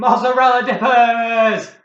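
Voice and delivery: high, loud, drawn-out cheer; excited shout. Real, not acted. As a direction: shout the prize in triumph. Celebrating the prize.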